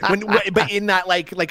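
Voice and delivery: normal talking voice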